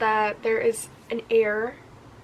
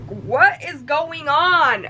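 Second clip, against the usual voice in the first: shocked disbelief; 5 semitones higher, 6 dB louder, much slower than usual. Retold or imitated. Her own shocked reaction.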